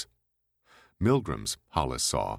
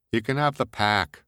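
brash woman voice; 7 semitones higher, 5 dB louder than usual. Acted. Heidi voiced.

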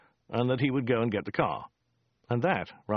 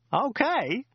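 shouted; 12 semitones higher than usual. Acted. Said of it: "shouted Joe".

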